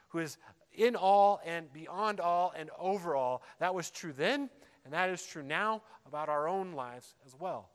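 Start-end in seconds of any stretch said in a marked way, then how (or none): none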